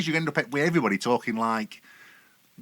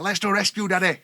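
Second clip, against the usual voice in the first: higher-pitched little boy playing rescuer; eager child voice, acted by dad; imitated excited child; 7 semitones higher, 4 dB louder than usual. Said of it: "he's like".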